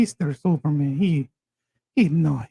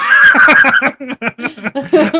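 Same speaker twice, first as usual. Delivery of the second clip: a big high laugh bursting out; loud burst of laughter; 11 semitones higher, 8 dB louder than usual. Real, not acted. Friend laughs hard at the costume idea, loud burst of laughter. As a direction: crack up loudly at the joke.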